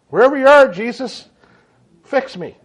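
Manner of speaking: desperate plea building to a plea for help; pleading prayer. Retold or imitated. Sick man praying aloud for Jesus to fix him. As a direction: cry out to Jesus, high and loud, then sink.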